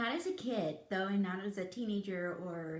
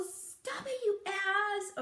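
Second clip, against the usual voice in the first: mock mad; 9 semitones higher, much slower than usual. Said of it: "if I get mad, I'll go".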